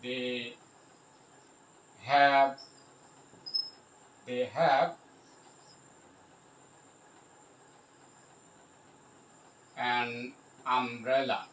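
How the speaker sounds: halting dictation with long gaps; word by word. Shown as a demonstration. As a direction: pause long between the words, as if writing. Spelling out example while writing.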